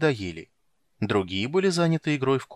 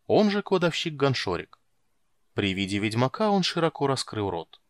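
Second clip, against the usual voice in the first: usual speaking voice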